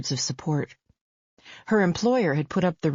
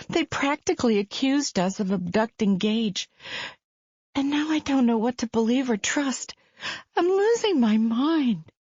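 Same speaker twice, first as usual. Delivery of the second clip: anxious mother voice climbing higher, growing panicked; a mother coming apart at the seams; building from worried to desperate; distraught mother; 6 semitones higher than usual. Acted. Faith's voice, rising panic.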